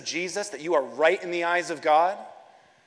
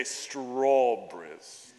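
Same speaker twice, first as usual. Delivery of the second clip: put-on strawberries in an English accent, slow STRAW-bries with no r; 3 semitones lower, much slower than usual. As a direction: draw out strawberries like a proper Englishman. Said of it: "if you wanna practice an English accent".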